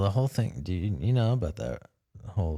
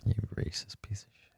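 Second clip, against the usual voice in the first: whispering; 9 dB quieter than usual. Acted. Joking aside while S0 talks.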